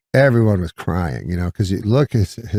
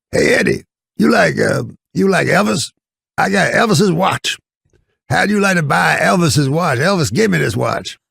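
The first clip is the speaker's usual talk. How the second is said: high, raspy hustler voice pitched way up; Red Fox voice; 10 semitones higher than usual. Retold or imitated. Eddie imitating Red Fox selling a watch, high put-on voice. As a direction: pitch it up like a sly old comic hawking goods. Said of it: "he's doing voices".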